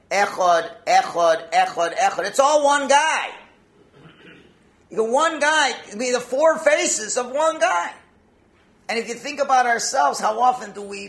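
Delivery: loud, high, insistent sermon climax; impassioned preaching. Real, not acted. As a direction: hammer it home to the congregation, stretching the key words. Preacher builds to full force.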